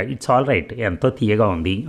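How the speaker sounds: usual speaking voice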